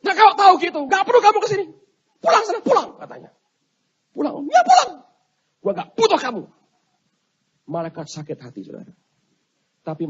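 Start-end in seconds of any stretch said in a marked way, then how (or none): 0.0-2.9 very high, loud shout; acted furious yell; angry dismissal. 4.2-4.5 meek high-voiced angel. Meek angel checks the dismissal. 4.5-6.4 high, loud explosive shout; acted angry yell; furious dismissal.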